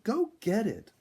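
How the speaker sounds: high, loud urging. A narrator's reading. Sonia urging the raccoon on again, high and loud. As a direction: push him on, high and loud. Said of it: "Sonia urged".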